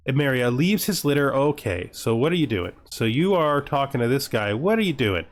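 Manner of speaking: ordinary talk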